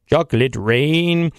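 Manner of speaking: sing-talk it like the viral singer; slowed crooning impression. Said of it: "the Chocolate Rain guy".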